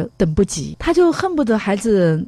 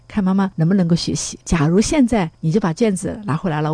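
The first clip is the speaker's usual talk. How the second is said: normal talking voice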